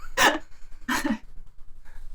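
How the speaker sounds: breathy unvoiced laugh; real laugh. Real, not acted. Co-host laughs after being called a turd, breathy laugh. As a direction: laugh silently, mostly air.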